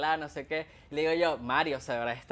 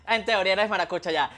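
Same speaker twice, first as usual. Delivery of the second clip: ordinary talk, nothing marked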